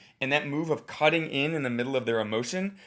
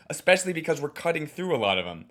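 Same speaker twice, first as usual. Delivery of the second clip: normal talking voice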